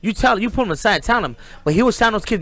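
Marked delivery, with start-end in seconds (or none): 0.0-1.3 retold warning. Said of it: "I was like".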